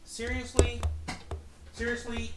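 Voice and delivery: high, incredulous "seriously?" twice; fed-up squeak of disbelief; exasperated disbelief. Real, not acted. Game goes wrong again.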